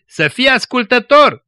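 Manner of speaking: put-on high, loud shout after the boy; calling out. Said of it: "striga câte o femeie".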